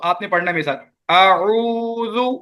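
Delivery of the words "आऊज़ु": आऊज़ु stretched very slowly, each sound distinct; slow recitation demo. Shown as a demonstration. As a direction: model आऊज़ु slowly for students to repeat. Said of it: "मैं पढ़ रहा हूँ". (आऊज़ु को बहुत धीरे, हर आवाज़ अलग)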